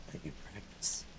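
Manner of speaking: breathy whispered aside. Real, not acted. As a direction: whisper it to yourself. Whispered aside about needing practice.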